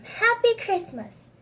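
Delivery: high, loud, drawn-out good wishes; warm greeting. A narrator's reading. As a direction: return the greeting heartily. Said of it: "answered with a warm".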